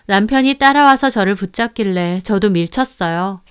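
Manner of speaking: ordinary delivery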